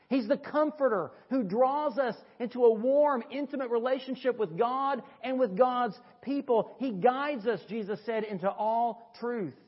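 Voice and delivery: high, loud, ringing pulpit voice; swelling preacher's cry; preaching at full force. Acted. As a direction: lift the congregation with it. Preaching builds to a peak.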